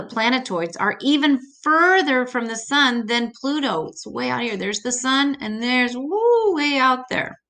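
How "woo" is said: excited whoop. Real, not acted. Teacher points far out in space.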